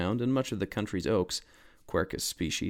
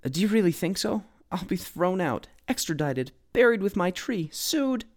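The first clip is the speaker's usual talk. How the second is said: higher and higher panicked woman; worried homeowner listing fears, rising ever higher; frantic; 10 semitones higher than usual. A narrator's reading. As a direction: spiral into panic. Frantic woman fearing eviction.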